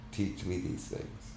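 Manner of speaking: breathy, broken whisper; whispered plea. Acted. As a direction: barely whisper it, near tears. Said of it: "his voice broke into a sob".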